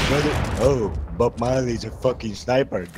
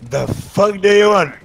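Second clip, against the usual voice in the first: louder, higher exasperated shout; annoyed yell; 7 semitones higher, 5 dB louder than usual. Real, not acted. Gamer yells at pestering teammate, exasperated shout. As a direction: snap at him, fed up.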